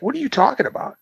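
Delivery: high-pitched exasperated question; high, incredulous comeback. Retold or imitated. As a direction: protest in baffled disbelief. Incredulous retort repeated.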